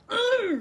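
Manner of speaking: loud put-on throat clear; faked throat clearing. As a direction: clear your throat loudly as an excuse. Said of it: "really sore throat".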